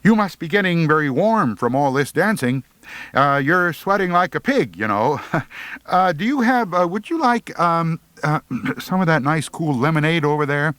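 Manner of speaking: high, fumbling would-be smooth talk; mock suave. A narrator's reading. Boy trying to charm Pearlie, high and fumbling. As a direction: try to sound smooth and keep stumbling. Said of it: "I said to her suavely".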